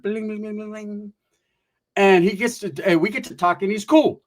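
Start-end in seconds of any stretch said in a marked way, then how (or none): 0.0-1.1 sparkling chime noise, fading softer; imitated bling sound. Mimicking the sparkle of his hairdo, light ringing bling. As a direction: ring it out like a cartoon twinkle. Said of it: "I could have swore I heard".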